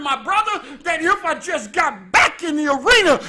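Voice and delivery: loud, driving sermon delivery; full-force preaching. Acted. Preacher driving home his point, loud and forceful. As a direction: preach it hard to the congregation.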